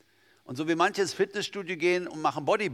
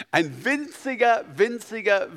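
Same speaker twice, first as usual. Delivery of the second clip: winziger said high and loud, drawn out; stressed winziger; 8 semitones higher, 6 dB louder, slower than usual. Real, not acted. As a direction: lean hard on winziger. Emphasizing tiny faith.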